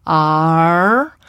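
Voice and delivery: R as a glide, vowel sliding into r; gliding R. Shown as a demonstration. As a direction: draw out R as a glide. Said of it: "functions in the same way as a diphthong".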